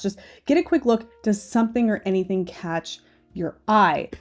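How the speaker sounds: ordinary delivery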